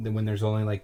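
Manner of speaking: ordinary talk, nothing marked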